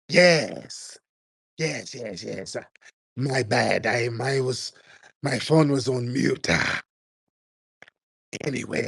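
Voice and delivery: mock caller voice. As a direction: put on the caller's voice, pausing between phrases. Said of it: "Why are you talking like that".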